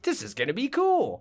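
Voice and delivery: high-pitched eager exclamation; mock youthful excitement. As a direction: play a thrilled beginner, pitch leaping up. Voicing his eager younger self.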